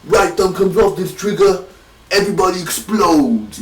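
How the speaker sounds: high-pitched imitated Brooklyn accent. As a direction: act out a movie threat in put-on Brooklyn. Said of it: "he does this Brooklyn accent".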